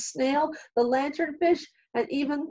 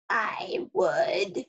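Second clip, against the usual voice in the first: a crusty coral, creaking the words out; creaky coral voice. A narrator's reading. The crusty coral answers the hermit crab, creaking. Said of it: "creaked a crusty coral".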